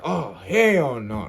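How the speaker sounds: loud, drawn-out refusal; retold shock, dragging the words; alarmed disbelief. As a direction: stretch it out slowly and loudly. Instant alarm at phone accusation.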